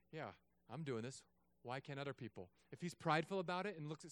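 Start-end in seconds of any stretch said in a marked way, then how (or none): 0.1-2.5 imagined smug thought. Retold or imitated. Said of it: "if he thinks in his heart".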